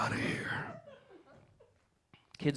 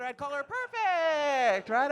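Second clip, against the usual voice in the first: slow, drawn out; 10 semitones higher, much slower than usual. Acted. About his wife, a slow drawn-out line. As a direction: drag every word, savouring it. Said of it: "wife jokes".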